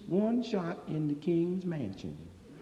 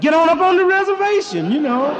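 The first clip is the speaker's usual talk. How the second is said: high loud retort; 11 semitones higher, 11 dB louder than usual. Acted. Owner's retort acted.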